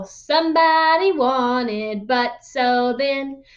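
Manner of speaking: slow, spelled-out Somebody wanted, but so then; teacher modelling the frame word by word; teaching drill. Shown as a demonstration. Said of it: "teacher drilling summary frame".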